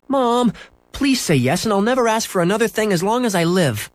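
high, urgent plea; begging boy. A narrator's reading. Boy promising mother anything for a dog, begging. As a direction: start very high, then keep pleading.

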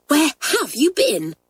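put-on a cross head girl wanting answers; Hilary demands where the girls have been; demanding. A narrator's reading. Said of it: "she demanded".

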